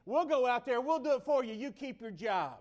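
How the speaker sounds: loud, high volunteering; defiant rallying. Acted. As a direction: step up boldly for the coach. Acted supporters volunteering for coach.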